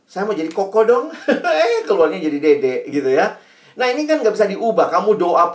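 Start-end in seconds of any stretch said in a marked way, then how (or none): 0.1-3.4 laughing. Retold or imitated. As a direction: chuckle through the child's wish. Mock wish, laughing.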